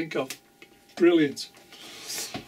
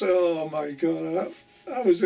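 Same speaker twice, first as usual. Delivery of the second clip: normal talking voice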